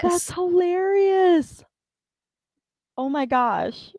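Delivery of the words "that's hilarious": drawn-out echo. Real, not acted. Echoes friend's words, drawn out.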